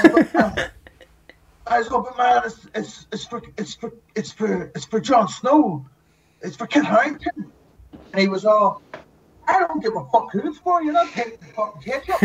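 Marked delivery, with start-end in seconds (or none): 0.0-7.5 high, stammering plea; high-pitched plea, slowing at Jon Snow; whiny pleading. Retold or imitated. Pleading with the man in line. 9.5-12.2 high, mocking voice of the man in line; mock the stubborn man in a high voice; squeaky imitation of a rude man; mock gruff refusal. Angry man refusing ketchup.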